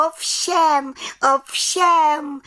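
very high, loud little witch cry; calling out. A narrator's reading. Little witch confirms her deeds to the council, calling out. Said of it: "volala malá čarodějnice".

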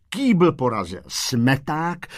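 ordinary delivery, nothing marked

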